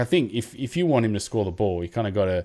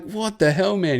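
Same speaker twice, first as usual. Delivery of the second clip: mock disbelief; 9 semitones higher than usual. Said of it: "And he's just like".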